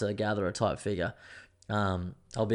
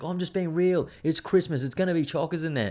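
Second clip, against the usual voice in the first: higher, mock-defensive mum voice; imitating mum; 7 semitones higher than usual. Son mimicking mum defending her car park warning, higher and insistent. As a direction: pitch up, insist like a mum who's sure she's right. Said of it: "She's like".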